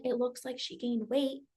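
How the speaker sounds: son's hurtful comment, hushed; hushed quoted jab. Retold or imitated. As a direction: murmur the remark snidely. Retelling the triggering comment.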